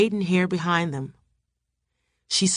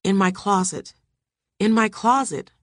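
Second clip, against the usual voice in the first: ordinary delivery, nothing marked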